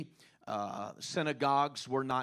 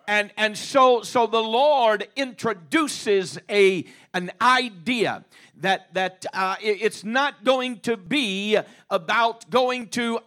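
rising, louder and louder sermon cadence; preaching at full force; 6 semitones higher, 9 dB louder than usual. Acted. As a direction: preach it out, building to a shout.

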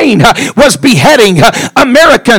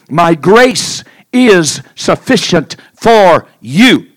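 slow quote; 4 semitones lower, much slower than usual. Acted. Slow emphatic scripture quote.

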